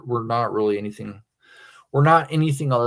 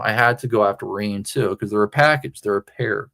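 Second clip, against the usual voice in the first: usual speaking voice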